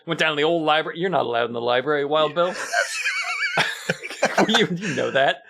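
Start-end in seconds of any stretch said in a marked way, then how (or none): 2.6-4.4 sudden squealing laugh; high burst of laughter. Real, not acted. Big laugh at own joke.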